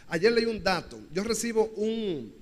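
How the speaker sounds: ordinary delivery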